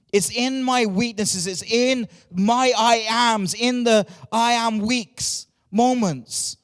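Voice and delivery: full-force sermon delivery; fervent preaching. Acted. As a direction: preach it with rising conviction. Preaching to the church.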